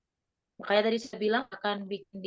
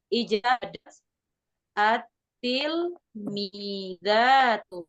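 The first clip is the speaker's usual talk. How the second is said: slow reading; much slower than usual. Shown as a demonstration. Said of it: "pelan-pelan".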